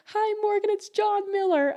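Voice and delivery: put-on sweet old man, high and slowing; John Miller. Old regular greeting his favourite server, sweet high voice. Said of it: "a really sweet old man".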